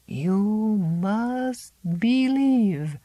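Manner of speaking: very slow, drawn out command; solemn urging. Acted. Old Zélie urging Miranda to believe, very slowly. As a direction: stretch every word like a spell.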